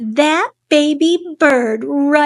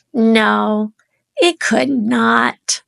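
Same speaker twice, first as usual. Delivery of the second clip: usual speaking voice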